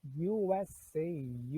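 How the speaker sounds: U S A spelled out letter by letter, very slowly. Shown as a demonstration. Teacher spells the acronym for students, slow and clear. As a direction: spell each letter apart, deliberately. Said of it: "é a sigla pra United States of America".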